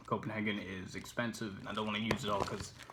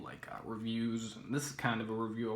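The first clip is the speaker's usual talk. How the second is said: ordinary delivery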